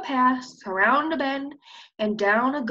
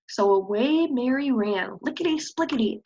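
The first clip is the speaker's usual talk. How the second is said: usual speaking voice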